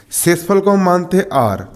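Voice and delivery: ordinary talk, nothing marked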